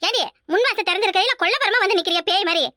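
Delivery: ordinary talk, nothing marked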